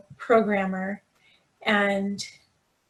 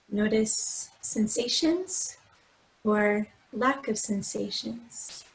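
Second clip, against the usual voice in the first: guided meditation; 4 dB quieter than usual. Acted. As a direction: gently guide them through breath and body, unhurried. Leads a body scan meditation.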